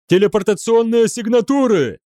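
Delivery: high, alarmed yell; panicked howl. A narrator's reading. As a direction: cry out the threat in panic. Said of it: "взвыл офицер слежения". (панический вопль)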